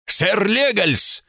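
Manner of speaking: slow booming call echoing off vaults; cathedral echo. A narrator's reading. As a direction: boom the name out slowly under stone arches. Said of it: "прогремел его мощный голос".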